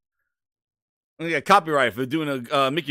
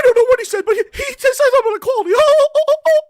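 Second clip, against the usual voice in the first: squeaky cartoon yelps; cartoon mouse voice; 11 dB louder, faster than usual. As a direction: squeal it like a panicking mascot. Continuing the Mickey Mouse bit.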